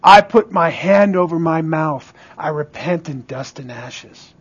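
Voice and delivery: low penitent confession, fading to very soft; humbled response. Acted. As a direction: sink down into quiet repentance. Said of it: "How did he respond to his vision of God?".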